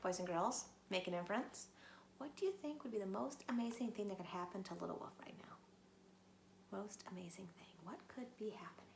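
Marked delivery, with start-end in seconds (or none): 2.2-8.9 soft breathy whisper to the class. Shown as a demonstration. Teacher whispers a question to the children. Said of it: "teacher whispers to class".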